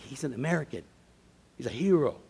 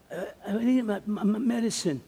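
lightly voiced old man, higher, halting; old veteran; 8 semitones higher than usual. Old veteran asking for his medicine at the pharmacy, halting.

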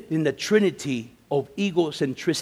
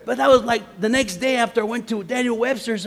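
ordinary talk

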